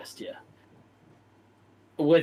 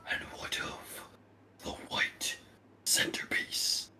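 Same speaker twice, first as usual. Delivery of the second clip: slow, menacing whisper; dark lord whisper; 11 dB quieter, slower than usual. Acted.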